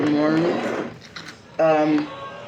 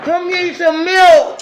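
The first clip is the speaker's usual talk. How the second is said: playful call; 14 semitones higher, 8 dB louder than usual. Acted. Playful high call amid laughter.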